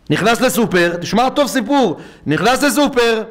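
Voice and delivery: grab the crowd with the story; animated sermon telling; preaching storyteller. Acted. Story told at preaching pitch.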